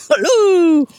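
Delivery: high, drawn-out goofy hullo; playful high copy of a goofy greeting; goofy echo. Retold or imitated. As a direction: echo a silly greeting, swooping down. Echoing the goofy hello, laughing.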